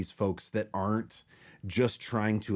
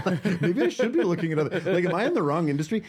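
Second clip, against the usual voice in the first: laughing; 8 semitones higher, 4 dB louder, much faster than usual. Real, not acted. Laughing at his own doubt.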